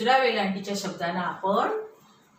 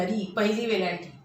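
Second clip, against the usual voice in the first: normal talking voice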